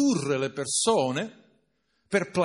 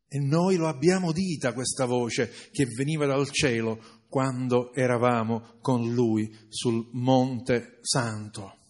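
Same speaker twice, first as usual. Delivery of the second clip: ordinary talk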